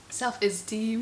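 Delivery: put-on copying a Somali accent on self-esteem; teasing Somali-accented repeat. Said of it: "Somali accent".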